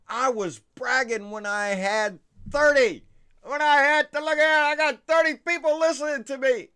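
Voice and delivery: loud, high, thrilled outburst; excited shout. Real, not acted. Host shouting to viewers about growth, thrilled and loud. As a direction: belt it out in real delight. Thrilled at his subscriber count.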